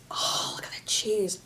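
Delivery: hushed whisper over the food. Real, not acted. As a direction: breathe it out in awe.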